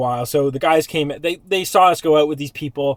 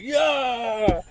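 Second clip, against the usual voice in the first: mock crowd cheer; 8 semitones higher than usual. Imitating the bar crowd cheering.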